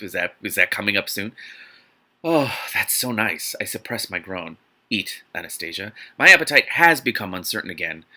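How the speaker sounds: ordinary talk, nothing marked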